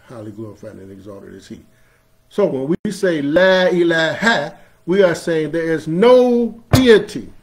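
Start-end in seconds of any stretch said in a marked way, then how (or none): 2.3-7.3 preaching. Acted.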